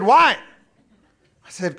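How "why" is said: high, loud why; God asking loudly; voice of the Lord. Retold or imitated. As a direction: question him as God would. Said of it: "He said".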